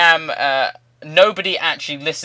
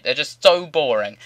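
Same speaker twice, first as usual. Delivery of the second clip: normal talking voice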